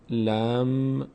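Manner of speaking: ordinary delivery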